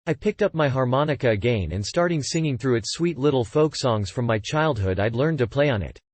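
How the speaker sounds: usual speaking voice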